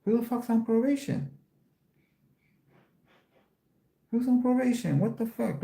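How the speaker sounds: high-pitched who's-on-probation outrage; outraged disbelief. Retold or imitated. Hearing the judge mention probation, outraged. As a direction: replay the courtroom shock, pitch way up.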